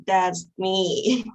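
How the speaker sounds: normal talking voice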